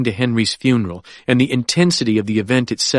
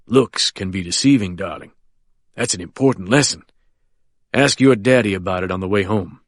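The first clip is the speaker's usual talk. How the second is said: lower, smooth reassuring voice; smiling at a frightened child; confident old lawyer; 3 semitones lower than usual. Acted. Quentin speaks to Annie.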